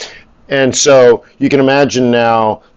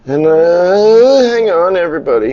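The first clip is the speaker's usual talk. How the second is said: stalling call; 7 semitones higher than usual. Real, not acted. Host asking viewers to wait while he types, high stalling call. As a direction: climb high as you hold everyone off.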